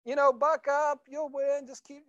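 put-on high, gentle consoling of a tearful boy; comforting his little son. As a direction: soothe him, coax him to buck up. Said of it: "I said".

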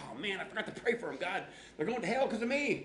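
high-pitched, hurried guilty panic; hammed-up alarm, pitch climbing; mock panic. Pastor mocking his old guilt over forgotten prayer, panicked.

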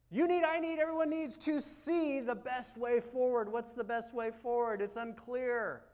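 high, loud, insistent preaching; impassioned preaching. Real, not acted. Preacher telling everyone what they need, high and forceful. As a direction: press it on the whole congregation.

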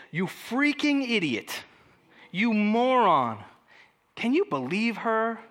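slow, drawn-out scorn; contemptuous insult. Shown as a demonstration. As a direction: stretch out each insult with contempt. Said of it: "translate "Raka," um, "Raka" is this".

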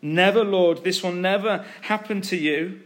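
insistent acted refusal, leaning hard on never; emphatic rebuke. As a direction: object forcefully, pitch lifted. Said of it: "he began to rebuke him".

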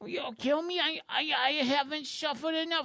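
high, stammering neurotic whine; Woody Allen. Acted. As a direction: plead like a nervous, put-upon nebbish. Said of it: "he kinda looks like Woody Allen".

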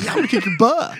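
put-on high, loud playful kid threat; kid's mock threat. Retelling a childhood fight to friends, high mock threat. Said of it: "couldn't cuss when I was a kid".

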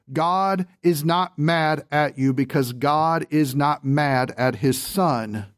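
usual speaking voice

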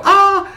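high yelp of fright; hammed-up high shriek; mock scream. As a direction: squeal like a startled clown. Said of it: "and I go".

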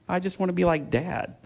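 lightly imitated little nephew; little child. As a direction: answer like an innocent toddler. Voices his two-year-old nephew.

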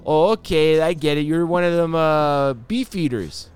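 high, loud, hammed-up Canadian friendliness; exaggerated Canadian buddy voice, high and loud; mock Canadian accent. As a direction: play an over-the-top friendly Canadian, eh. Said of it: "That's not how you talk".

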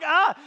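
loud high mock shriek; loud, high-pitched mock shriek; startled yelp. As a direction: yelp as if burnt. Mom burning magazines, startled.

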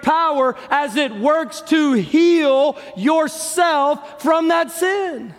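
high-pitched preaching, slowing and stretching toward the end; impassioned preaching. Acted. Preacher urging the congregation to confess and seek Jesus, impassioned, high. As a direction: build up and draw out the last phrases. Sermon builds to a climax.

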